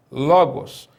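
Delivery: ordinary talk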